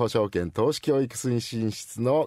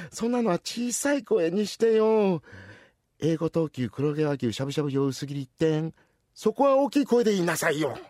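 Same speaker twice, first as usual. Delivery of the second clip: ordinary delivery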